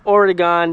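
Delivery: "Oregon" with a full GAHN last syllable; mispronunciation demo. Shown as a demonstration. Vlogger correcting outsiders, "Oregon" mispronounced with a gone ending. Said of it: "If you pronounce it".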